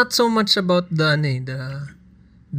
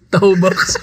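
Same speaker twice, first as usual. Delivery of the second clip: higher, breaking into laughter; laughing; 7 semitones higher than usual. Real, not acted. Friend laughing at the name Tahoe box. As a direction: repeat it while cracking up.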